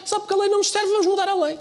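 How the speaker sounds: high, whiny mock voice; mocking whiny politician. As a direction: sneer it in a silly high voice. Mocking the party's excuse.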